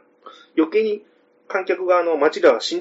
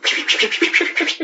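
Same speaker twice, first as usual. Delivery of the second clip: 高く連続する電子音の真似; ピピピと甲高く鳴らす; 警報音の口真似; 12 semitones higher than usual. Acted. 防犯ブザーの音を真似. (高い警報音を口で再現)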